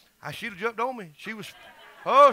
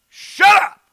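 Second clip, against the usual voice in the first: sudden full-force yell, much higher and louder; shout; 11 semitones higher, 15 dB louder than usual. Retold or imitated. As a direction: bark it at her to stop her cold. Preacher retells shouting at granddaughter.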